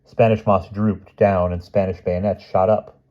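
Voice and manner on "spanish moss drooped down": plain reading voice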